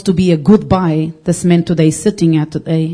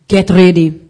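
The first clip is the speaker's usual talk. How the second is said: commanding voice; 5 dB louder than usual. Retold or imitated. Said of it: "the voice said to me".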